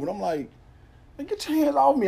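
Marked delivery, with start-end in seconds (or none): 1.2-2.1 high, sharp protest; angry protest. Retold or imitated. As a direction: pull free and tell him off. Officer grabs his arm.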